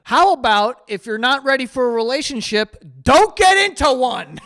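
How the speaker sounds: loud, high yell of an online rant; mock yelling. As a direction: shout it like an all-caps post. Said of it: "They're, like, yelling through this thread".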